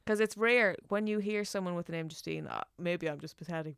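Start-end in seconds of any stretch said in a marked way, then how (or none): none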